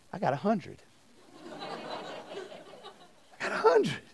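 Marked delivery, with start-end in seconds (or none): 1.2-4.0 slow, higher, laughing through it. Real, not acted. Preacher telling students about his perfect quiz score, laughing slowly. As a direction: savor the punchline, laughing. Punchline repeated while laughing.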